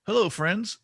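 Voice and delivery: high, loud bright greeting; upbeat host welcome; cheery podcast hello. Shown as a demonstration. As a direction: greet your listeners like a host. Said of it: "But I always say".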